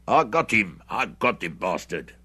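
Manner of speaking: excited shout. A narrator's reading. Hendry boasts of the shot, excited shouting. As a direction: crow over the kill, loud at first. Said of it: "shouting with hoarse excitement".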